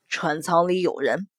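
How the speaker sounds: low, quiet grave voice; low man's voice. Acted. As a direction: deliver it low and grim. Mo Jie grave report.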